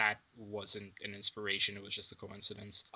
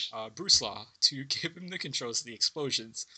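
ordinary talk

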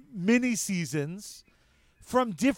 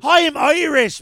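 bad Irish accent; 4 semitones higher, 9 dB louder, slower than usual. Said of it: "a really bad Irish accent".

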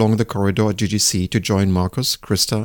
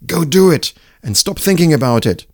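inner shout; 7 semitones higher, 3 dB louder than usual. Retold or imitated. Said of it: "just wanted to shout".

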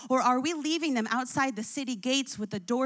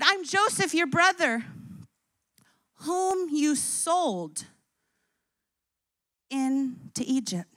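tender; slower than usual. Acted. Joseph tenderly reveals himself.

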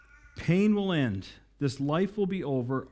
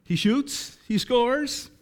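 much higher, louder game-call cry; hockey goal call; 10 semitones higher, 4 dB louder, much slower than usual. Acted. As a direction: whip up the room like an arena announcer. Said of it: "re-enacting the call".

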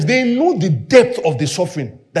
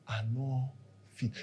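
inner thought; 9 semitones lower, 21 dB quieter than usual. Retold or imitated. Mimes a quiet inner thought.

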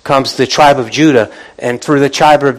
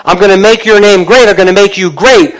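high, loud, driving proclamation; excited preaching; 6 semitones higher, 4 dB louder than usual. Real, not acted. Preacher voicing God's blessing to the congregation, excited. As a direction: preach it out with real excitement, punching key words. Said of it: "not excited about that as I am".

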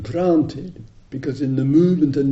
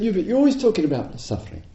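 quick, higher nagging tone; mock complaint; 7 semitones higher, faster than usual. Retreatant complaining to the teacher, quick and mocking. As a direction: rattle off the grievance. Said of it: "And she said".

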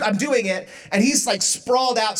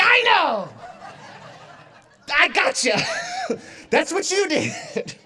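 mock indignant outburst; 8 semitones higher than usual. Acted retort in a couple's quarrel.

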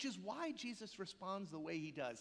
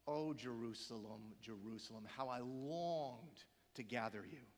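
yearn quietly over the names, much lower; Jesus, tearful longing; 8 semitones lower, 3 dB quieter than usual. Retold or imitated. Jesus crying over Jerusalem, tearful and longing. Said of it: "through tears, what does Jesus say?".

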